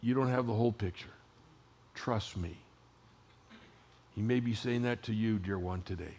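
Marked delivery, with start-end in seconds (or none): 0.0-2.6 gentle father. Retold or imitated. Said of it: "the Father had to say".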